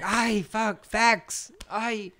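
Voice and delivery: high mock wail of defeat; hammed-up groan of someone who lost the argument; mock pained defeat. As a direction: whine it like the facts hurt. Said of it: "They're like".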